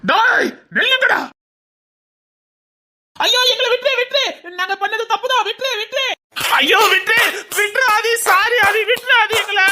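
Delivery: high, whimpering pleas; begging for mercy. Acted. Beaten thug begs Aadhi for mercy, high desperate pleading.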